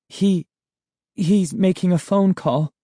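put-on a touch of a shaky, stammering girl. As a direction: stammer it out nervously. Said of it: "Sari replied in a shaky voice".

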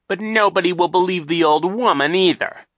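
high, loud impatient sneer; impatient villain. Acted. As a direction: brush them off impatiently. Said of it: "Captain Sham said impatiently".

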